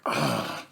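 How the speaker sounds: low groan of dread; acted sinking sigh. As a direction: let out a weary groan at unwelcome news. Dread as client calls.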